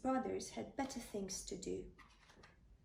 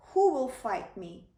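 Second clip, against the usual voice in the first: a loud booming giant's challenge; 8 dB louder than usual. Acted. Goliath challenging the Israelites, loud and booming. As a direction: boom it across the valley. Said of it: "boomed Goliath".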